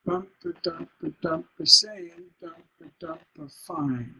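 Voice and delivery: rhythmic chant, weak tail on "saying", firm landing on "fine"; stressed "dump" beats, stressed final "fine"; feminine resolving to masculine. Shown as a demonstration. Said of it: "feminine ending wants to have a masculine".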